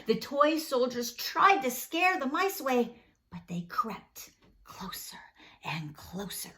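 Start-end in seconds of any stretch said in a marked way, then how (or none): 3.3-6.5 hushed. A narrator's reading. Narration of creeping mice.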